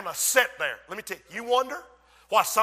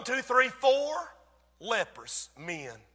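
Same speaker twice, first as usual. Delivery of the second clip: ordinary delivery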